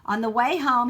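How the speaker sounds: ordinary delivery, nothing marked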